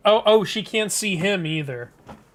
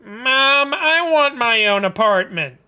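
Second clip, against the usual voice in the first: loud, raised pestering voice; whiny demand; 3 semitones higher, 5 dB louder than usual. Acted. Son demanding his own apartment from mom, whiny. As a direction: whine at mom like a grown child.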